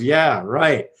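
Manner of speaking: low, dismissive "yeah, right"; sarcastic brain voice. Retold or imitated. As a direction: scoff at it like you don't buy it. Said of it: "Your brain goes".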